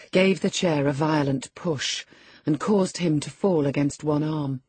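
usual speaking voice

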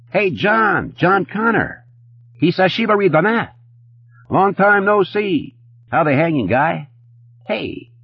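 very high-pitched, hyper greeting; over-excited high character voice; manic fast-talking Eddie. A narrator's reading. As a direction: hail an old pal in a high, edgy voice. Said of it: "he spoke quickly".

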